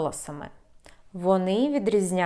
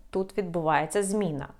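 ordinary talk, nothing marked

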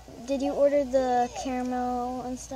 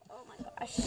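whisper; 13 dB quieter, much faster than usual. Real, not acted. Whispered aside.

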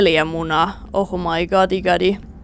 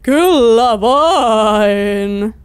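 extremely slow, each vowel stretched long; drawn-out words; 2 semitones lower, much slower than usual. Real, not acted. Player talking over the game, very drawn-out delivery. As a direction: stretch two words across several seconds. Drawn-out reply during play.

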